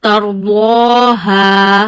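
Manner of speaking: ترضاها with the alif held long, slow; slow Quranic recitation of ترضاها; stretched alif. Shown as a demonstration. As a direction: recite ترضاها drawing out each long vowel. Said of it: "So stretch it up to one finger".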